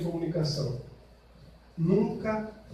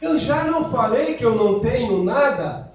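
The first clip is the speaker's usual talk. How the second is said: higher, louder irritated snap; snapping; 5 semitones higher, 6 dB louder than usual. Acted. Wife snaps at the caring husband in irritation. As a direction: bite back at him.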